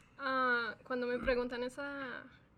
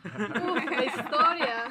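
laughing out the words, a touch louder; 2 semitones higher, 11 dB louder, slower than usual. Real, not acted. Guest laughing as she begins the story of Gigante. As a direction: chuckle through it as you start the tale.

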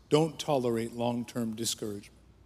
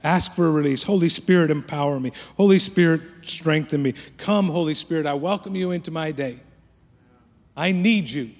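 loud, rising prayer cries to the Spirit; fervent prayer; 3 semitones higher, 6 dB louder than usual. Shown as a demonstration. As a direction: call out to God with longing. Prayed aloud at full force.

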